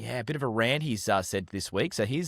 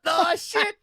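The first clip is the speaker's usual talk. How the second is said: surprised; 3 dB louder than usual. Real, not acted. Fumbled tongue twister, laughing.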